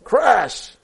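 high, loud burst; storybook crash. A narrator's reading. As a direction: hit it like a sudden collision.

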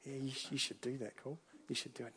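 soft nudging inner voice; little inner voice. Retold or imitated. Preacher voicing the little inner voice urging him, softly. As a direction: coax gently like a voice at the back of the mind. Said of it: "there's this little voice going".

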